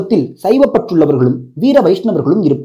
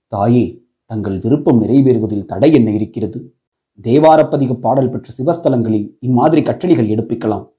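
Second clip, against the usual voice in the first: deep-voiced Saivite priest addressing the queen; temple priest voice; 7 semitones lower than usual. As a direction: lower voice of a respectful priest.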